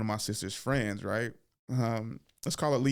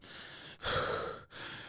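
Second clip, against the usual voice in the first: heavy breathing; 9 dB quieter than usual. Acted. Hider giving himself away to his cousin, heavy breathing. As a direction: breathe heavily, slowly, like someone hiding.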